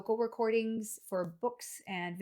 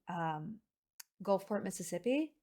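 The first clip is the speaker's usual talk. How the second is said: normal talking voice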